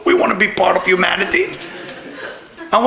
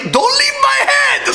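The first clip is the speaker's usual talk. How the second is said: high-pitched mock scream; imitating a panicked scream; retold scream. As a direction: shriek it as if into someone's ear. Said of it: "Debbie screamed at my ear".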